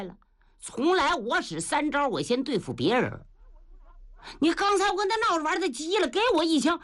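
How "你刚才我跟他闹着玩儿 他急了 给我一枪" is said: high, fast and flustered; flustered Niu Gao. Acted. Niu Gao startled by a sudden spear thrust, high and rushed. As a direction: rattle it off high in a panic.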